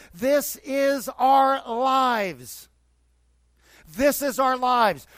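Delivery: slow, high and loud proclamation; emphatic preaching. Acted. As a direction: hammer the point to the congregation. Preaching climax, repeated.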